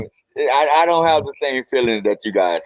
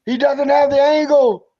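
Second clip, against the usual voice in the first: high, drawn-out play-by-play call; sportscaster's call; 12 semitones higher, 3 dB louder, slower than usual. Retold or imitated. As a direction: imitate an excited commentator, pitched way up. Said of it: "made the call?".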